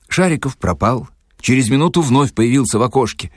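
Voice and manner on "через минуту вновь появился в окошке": ordinary delivery, nothing marked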